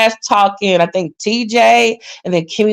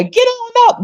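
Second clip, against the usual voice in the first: backing echo man; 12 semitones higher than usual. Retold or imitated. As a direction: chirp it right behind the lead. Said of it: "Every time [name] say".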